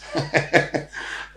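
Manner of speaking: breathy burst of real laughter, high. Real, not acted. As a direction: laugh it off, a bit embarrassed. Laughs about ex-girlfriends.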